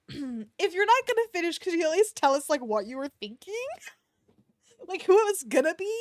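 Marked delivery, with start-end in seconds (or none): none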